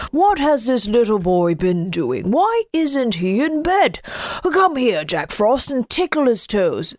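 ordinary delivery, nothing marked

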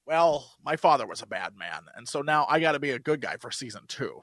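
low, deadpan mock hero line; mock tough guy. Mocking Ward's big moment, low and deadpan. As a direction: parody a cheap hero moment. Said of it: "they're like".

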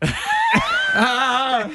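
a very high shrill shriek; high panicked shriek. Retold or imitated. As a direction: shriek it out like a starstruck fan. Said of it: "This is the sound I made".

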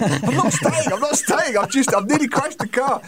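high, laughing, frantic denial; hammed-up breathless excuse; retold flustered panic. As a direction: driver explaining himself to the motel clerk. Flustered reply to motel staff.

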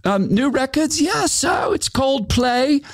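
high, chatty put-on voice; Glenn on the phone. Glenn chatting with Matthew about records, in a high put-on voice. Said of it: "He goes".

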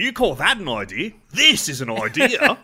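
show-off Aussie bravado in a put-on accent; mock Australian accent. Riffs on the Australian accent bit.

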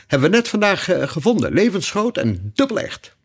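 high, eager Hare; eager announcement, pitched well up; enthusiastic. A narrator's reading. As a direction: present the find with excited high pitch. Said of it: "reageerde Haas enthousiast".

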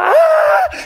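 high, loud mock scream of a scared kid; a kid screaming in fright at a bull; terrified scream. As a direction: shriek like a terrified nine-year-old. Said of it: "you know how dramatic I am, so I'm like".

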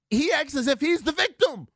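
very high-pitched exasperated protest; indignant outcry. Real, not acted. Radio host ranting about Bradley Beal, indignant.

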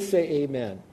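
ordinary delivery

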